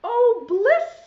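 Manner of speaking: high, loud rising sigh of delight; blissful. Acted. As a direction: melt into the warm bath. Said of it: "says the cow".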